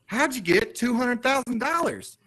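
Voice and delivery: put-on high-pitched, wide-eyed kid voice; amazed young son. Son asking dad about house money, in amazed high voice. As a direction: play an impressed kid, drawn out at the end. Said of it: "he was just like".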